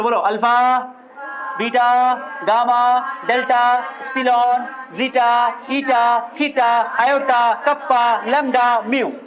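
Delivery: each Greek letter called out loud and clear for the class to repeat; loud drill recitation. Shown as a demonstration. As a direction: chant the alphabet like a teacher leading a drill. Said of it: "चलो एक बार repeat करो". (कक्षा को दोहराने के लिए हर अक्षर ज़ोर से बोलना)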